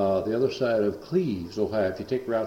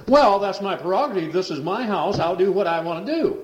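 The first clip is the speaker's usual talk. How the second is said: much higher, louder indignant retort; mock defiance; 10 semitones higher, 6 dB louder than usual. Son defending his right to the preacher, much higher and louder. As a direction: puff up and defy him. Possessed man defends himself angrily.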